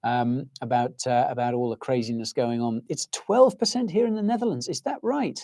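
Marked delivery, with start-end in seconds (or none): 2.9-5.4 high-pitched, incredulous questioning; real astonishment. Real, not acted. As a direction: check a shocking figure, pitched right up. Startled by viewers' inflation figures.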